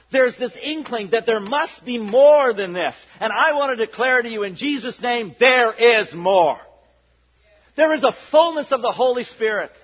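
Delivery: loud, building sermon declaration; fervent preaching. Real, not acted. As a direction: declare it over the congregation. Declaring fullness of Spirit.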